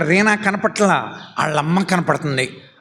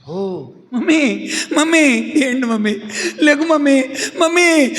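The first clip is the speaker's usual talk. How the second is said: shouting for mummy; 10 semitones higher than usual. Acted. Reena screaming for her fallen mummy to get up. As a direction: cry out desperately to wake her. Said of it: "అరుస్తూ ఉంది రీనా".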